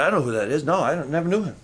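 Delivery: preacher voicing Peter's denial, low and quiet; lightly voiced Peter denying. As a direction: mumble it like you want to hide. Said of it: "And Peter said".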